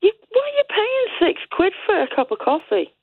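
high, disbelieving question; can't believe the price; incredulous. Real, not acted. Incredulous question about coffee price.

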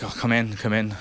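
ordinary talk

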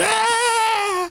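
high piercing shriek; sudden shriek. Retold or imitated. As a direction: let out a startled shriek. Scared of uncle's monkey as kid.

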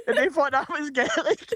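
high-pitched, laughing delivery. Real, not acted. Comedian finishing his Gaelic mix-up story, laughing. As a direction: land the punchline through real laughter.

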